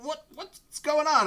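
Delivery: high-pitched, confused goblin voice; startled goblin. Acted. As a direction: squeak it out like a puzzled goblin. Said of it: "He's like".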